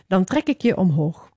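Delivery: ordinary delivery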